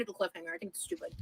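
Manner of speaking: whispered annoyed aside. Real, not acted. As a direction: mutter it under your breath. Annoyed aside about cliffhanger.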